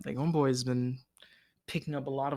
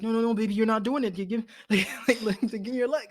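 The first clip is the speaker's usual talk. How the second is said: higher, louder, half-crying plea; mock desperate father; 9 semitones higher, 4 dB louder, faster than usual. Retelling Joel lifting his hurt daughter, desperate crying plea. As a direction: beg her to hold on, voice breaking.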